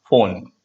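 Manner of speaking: "Phone" begun with F, said low; correct F sound. Shown as a demonstration. Teacher confirming for students, "Phone" with an F sound. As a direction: say "Phone" with F, dropping the pitch. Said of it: "the sound is the F sound".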